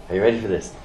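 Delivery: ordinary talk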